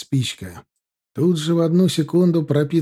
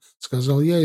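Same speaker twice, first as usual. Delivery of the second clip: plain reading voice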